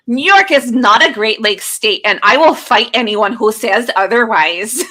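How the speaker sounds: put-on loud, playful Midwest accent read. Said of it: "I had to read that in my Midwest accent".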